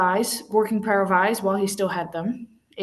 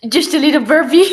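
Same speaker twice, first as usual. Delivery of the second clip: louder, giggling at own burp; laughing; 9 semitones higher, 10 dB louder, faster than usual. Real, not acted. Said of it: "joking about burp".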